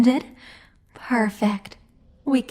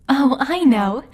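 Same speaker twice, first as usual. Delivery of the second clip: sudden idea; 4 semitones higher, 5 dB louder than usual. Acted. Demon lord gets a sudden idea.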